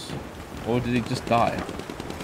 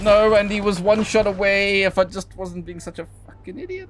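high-pitched lament, fading as it goes; self-berating wail; 8 semitones higher than usual. Real, not acted. Player rues missing a kill, high fading wail. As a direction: wail at your own stupidity, then trail away.

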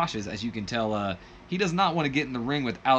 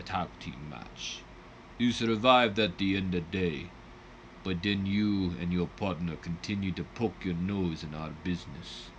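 lower, slow, menacing fake Russian accent; horrible Russian accent; 5 semitones lower, 5 dB quieter than usual. The Butcher taunting Robert Fuller before the match, hammy Russian accent. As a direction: taunt the rock star like a cartoon Russian villain. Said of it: "Horrible Russian accent, by the way".

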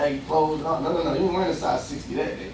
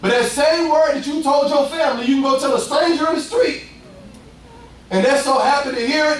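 ordinary delivery

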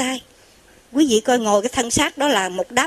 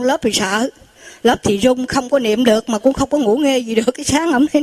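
ordinary delivery

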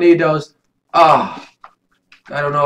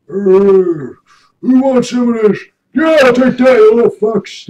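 higher, louder swaggering tough-guy taunt; cocky taunt; 7 semitones higher, 6 dB louder than usual. Acted. Tank driver taunts the little enemies, loud cocky boast. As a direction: bark it like a bully in a tank. Quoting his character's taunts.

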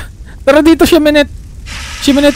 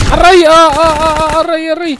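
mock pain cries; 4 semitones higher than usual. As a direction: cry out as if being hit, playfully. Hit in game, crying out. (pabirong daing sa sakit)

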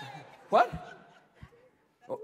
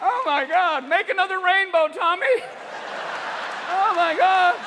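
high, loud, giddy stoner awe; hammed-up stoned delight; stoned firefighters; 9 semitones higher, 7 dB louder than usual. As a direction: gush like a firefighter high on the smoke. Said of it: "even the guys fighting the fires are like".

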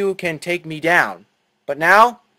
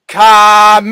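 full-throated attack shout; anime brute; 5 semitones higher, 11 dB louder than usual. Shown as a demonstration. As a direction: bellow the attack name. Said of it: "Then shout".

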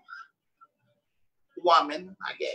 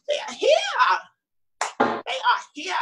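high, drawn-out playful call; playful announcement; 11 semitones higher, much slower than usual. Real, not acted. Host playfully announcing the girls to viewers, high and drawn out. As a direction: sing out the arrival, stretching the words.

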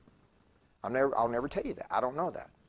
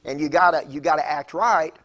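louder, punching the call to act right; forceful preaching; 3 semitones higher, 8 dB louder than usual. Real, not acted. Preacher exhorting his congregation to act right, loud and firm. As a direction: drive it home to the crowd. Preacher driving home the point.

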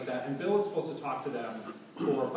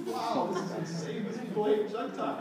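amused disbelief; 7 semitones higher than usual. Retold or imitated. Retold disbelieving thought.

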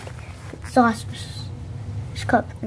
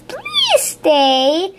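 higher, pleading spider voice; begging; 8 semitones higher than usual. Acted. Reading aloud, Miss Spider begging the beetles to stay. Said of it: "Miss Spider begged".

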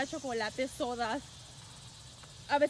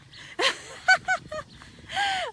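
high laugh; 3 dB louder than usual. Real, not acted. Laughing at a chat comment.

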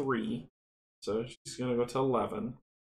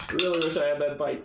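louder rueful; 6 dB louder than usual. Real, not acted. Wistful repeat after a laugh.

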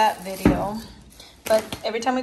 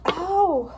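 sharp high "ow" of pain; sudden yelp of pain; 7 semitones higher than usual. Real, not acted. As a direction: yelp as if you just got cut. Cuts herself while cooking.

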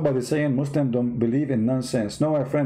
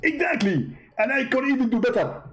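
high-pitched mock debater voice; a squeaky, smug rival preacher; Zakir Naik impression; 8 semitones higher than usual. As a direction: push the voice way up and lampoon him. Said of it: "I am Doctor Zakir Naik".

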